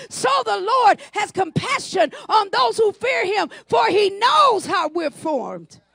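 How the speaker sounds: ringing, declaimed psalm with stretched phrases; preacher's impassioned recitation building and then easing; scripture proclamation. Real, not acted.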